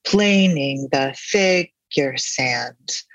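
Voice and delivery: very slow, beat on "Plan" in "Planing" and "fig" in "figure"; drawn-out metrical chant of "Planing the figure sand"; dactylic stress. Shown as a demonstration. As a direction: punch "Plan" and "fig", leave the syllables after them unstressed. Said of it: "he emphasizes the first of three syllables".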